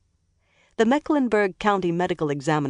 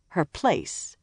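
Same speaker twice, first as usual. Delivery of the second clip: usual speaking voice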